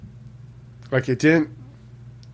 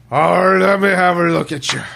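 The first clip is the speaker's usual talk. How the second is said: loud, higher slurred Sean Connery impression; drunk Sean Connery; 6 semitones higher, 7 dB louder than usual. Cohost mocking him as drunk Sean Connery, loud slurring. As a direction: Sean Connery after eight martinis. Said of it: "Sean Connery after ... eight martinis".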